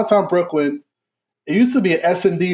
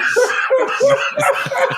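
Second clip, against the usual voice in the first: squealing laugh; high burst of laughter. Real, not acted. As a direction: crack up high. Big laugh.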